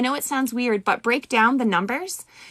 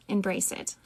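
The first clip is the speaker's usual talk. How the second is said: Embrace it, dropped much softer and lower; soft echo; 3 semitones lower, 9 dB quieter than usual. Acted. Reader echoing her own advice to viewers, softly. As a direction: let the repeat fade to a gentle hush.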